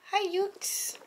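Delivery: high, soft, sing-song greeting; cooing at a visitor. Real, not acted. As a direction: coo the hello way up high and softly. Greeting a visitor who arrives.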